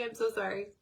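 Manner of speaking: usual speaking voice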